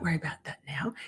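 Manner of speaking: fast whispered aside. Real, not acted. Streamer hinting at drama to chat, quick whispered aside. Dropping a topic.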